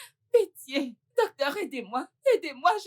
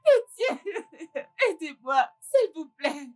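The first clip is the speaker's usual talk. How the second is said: begging between sobs; sobbing plea; slower than usual. Acted. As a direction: cry and beg the doctor not to give up on her son. Mother keeps begging in tears. (la mère supplie le docteur entre deux sanglots)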